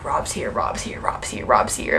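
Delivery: hushed excited whisper; whispered buzz. Retold or imitated. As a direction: whisper it like a murmuring crowd. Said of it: "a buzz rippling through the audience".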